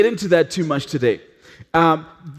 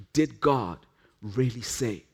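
much softer and lower, almost a whisper; hushed doubt; 8 semitones lower, 10 dB quieter than usual. Acted. Preacher voicing the serpent's whisper, soft and low. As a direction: let it creep in like a gnawing thought.

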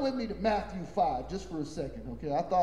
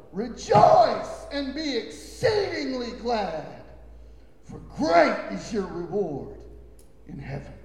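preached proclamation; 4 semitones higher, 5 dB louder than usual. Acted. Preaching scripture with force.

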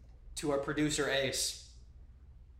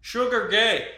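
raised, louder mock taunt; heckler's call; 5 semitones higher, 7 dB louder, slower than usual.

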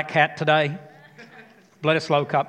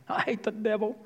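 mocking quote; 6 semitones higher, 6 dB quieter than usual. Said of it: "Why do people even talk like that?".

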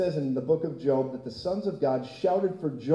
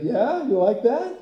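playful God; 7 semitones higher, 4 dB louder, slower than usual. Acted. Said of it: "He's like".

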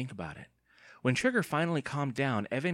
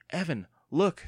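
louder, higher exclamation; surprised; 7 semitones higher, 6 dB louder than usual. A narrator's reading. Andy grabs the can and shows Evan, surprised. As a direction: call attention in amazement. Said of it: "eyes growing wide with surprise".

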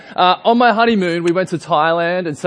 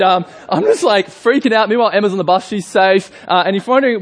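ordinary talk

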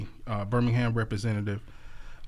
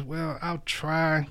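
slow drawn-out reluctance; 5 semitones higher, much slower than usual. Acted.

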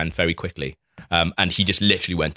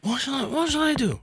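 high, soft mock panic; imitating his own flustered indecision; retold panicked dithering; 5 dB quieter than usual. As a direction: dither in a small, high-pitched voice.

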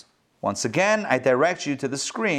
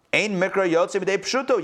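ordinary talk, nothing marked

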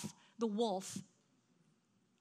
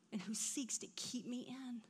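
unvoiced and much softer; grateful whisper; 14 dB quieter than usual. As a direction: breathe it out in gratitude. Tender whispered close of point.

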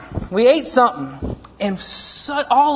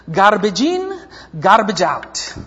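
put-on a light Italian accent on "Garbage in, garbage out", like his mother; mother's Italian accent; slower than usual. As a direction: quote mom's phrase in a hint of her Italian accent. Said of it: "has an incredibly beautiful Italian thick accent".

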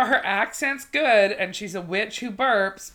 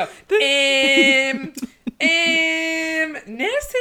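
high, held-out Scottish caricature; mock Scottish accent; 9 semitones higher, 4 dB louder, much slower than usual. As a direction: imitate Scots with long, high drawn-out sounds. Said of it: "They all just go".

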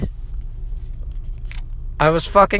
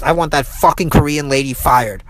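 usual speaking voice

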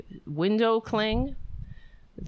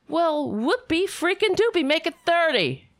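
high, mocking sarcasm, a bit louder; sarcastic scoff; 10 semitones higher, 5 dB louder than usual. Real, not acted. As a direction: scoff at the offer. Mocking Amazon's boast.

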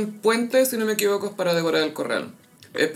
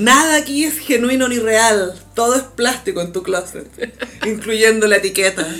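mock label voice; 4 semitones higher, 4 dB louder than usual. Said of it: "era como".

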